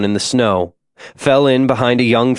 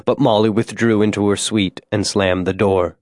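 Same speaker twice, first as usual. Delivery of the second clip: plain reading voice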